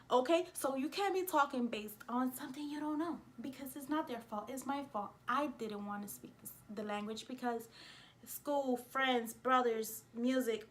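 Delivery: ordinary talk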